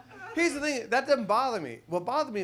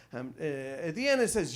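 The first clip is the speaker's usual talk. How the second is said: ordinary talk, nothing marked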